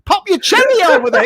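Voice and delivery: usual speaking voice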